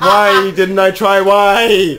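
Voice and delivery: loud, slow, high self-mocking wail; hammed-up laughing lament. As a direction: drag out every word through laughter. Repeats it exaggerated, laughing.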